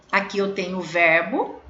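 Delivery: ordinary talk, nothing marked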